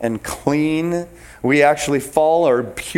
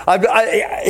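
ordinary talk

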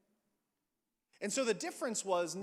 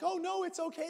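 high, sing-song imitation of a mom brushing it off; mock reassuring mom; 12 semitones higher, 4 dB louder than usual. Mother reassuring the stranger holding her baby, high mock lightness. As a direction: lightly play the mother saying all is fine. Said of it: "and then the mom's like".

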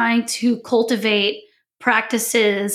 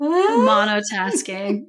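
slow flat drawn-out monotone; much slower than usual. Shown as a demonstration. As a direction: stretch it out dull and flat.

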